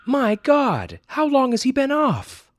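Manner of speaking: high, loud alarmed demand; a mother confronting her son. Acted. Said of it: "Mommy demanded".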